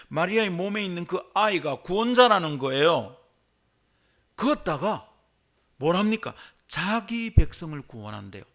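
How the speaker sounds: loud, high, excited sermon delivery; fired-up preaching. Acted. Preaching at full force.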